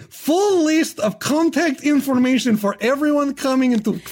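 usual speaking voice

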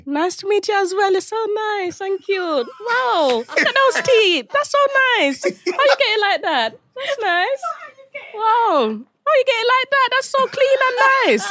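high, gushing auntie admiring his teeth; Pauline voice. Acted. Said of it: "so Pauline was in the room".

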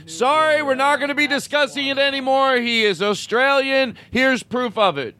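very high voice, slowing at the end; mock-emphatic declaration. Mock-emphatic closing of the argument.

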